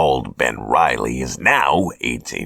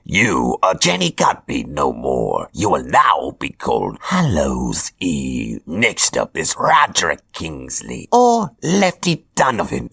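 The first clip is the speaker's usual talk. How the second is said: higher, theatrical villain voice; Hobgoblin voice; 4 semitones higher than usual. Acted. Hobgoblin announces his chosen apprentices, smug villain voice. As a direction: play a smug supervillain handing out orders.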